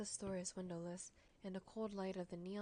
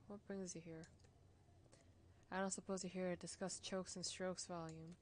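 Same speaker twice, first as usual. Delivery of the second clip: ordinary talk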